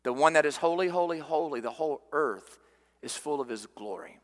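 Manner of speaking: quoting the angels with hushed awe; hushed reverence. Retold or imitated. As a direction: let it fade away in awe. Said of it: "the angels sing".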